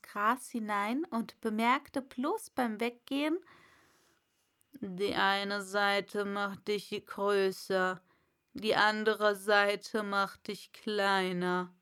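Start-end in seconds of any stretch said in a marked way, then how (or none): 4.8-11.7 caterpillar. Acted. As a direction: drop the hint lazily while leaving.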